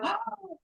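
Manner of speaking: quick surprised intake of breath; sharp gasp. Retold or imitated. Clients hearing the yawn story, a sharp gasp. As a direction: gasp as if it just clicked. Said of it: "and they go".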